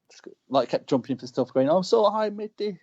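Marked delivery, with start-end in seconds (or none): 1.7-2.8 high-pitched teasing taunt; playful ribbing. Retold or imitated. Wrestler goading his rival mid-match, playful ribbing. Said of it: "I was just ribbing and going".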